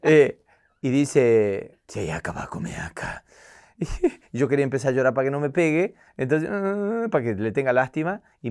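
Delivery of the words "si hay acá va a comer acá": very low, quiet growl; mother threatening again, Batman voice. Retold or imitated. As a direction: rumble it like Batman. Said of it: "Tiraste la voz de Batman".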